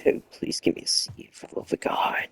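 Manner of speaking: hushed whisper so nobody wakes; late-night whispered begging; whispered plea. Real, not acted. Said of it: "unvoiced whisper".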